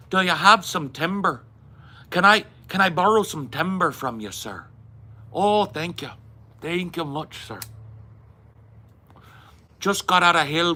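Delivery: high, loud cartoonish Irish accent; mock bad Irish accent. Mocking the actor's fake Irish accent on set. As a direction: ham up a fake stage Irish. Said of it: "his Irish accent is cartoonish as fuck".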